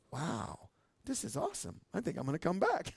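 hushed, understated retold amazement; soft "wow", as if thinking to yourself; quietly impressed. Preacher recalling his first church visits, quietly impressed.

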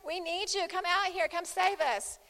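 high, loud panicked plea for rescue; panicked yelling. Retold or imitated. Disciples yelling at Jesus in the storm, panicked and loud. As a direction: cry out in terror to a sleeping master. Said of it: "and they're yelling".

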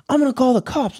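high-pitched mock threat; imitated threatening girlfriend. Girlfriend threatening to call the cops, mock high voice. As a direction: mimic her up in a high voice.

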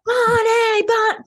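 high, wailing cry for Bonnie; mock panic. Mocking Lauren calling for Bonnie, hammed-up panicky wail. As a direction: ham up a drowning plea. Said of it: "Lauren's acting like she's in the middle of the ocean".

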